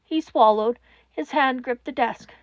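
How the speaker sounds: ordinary delivery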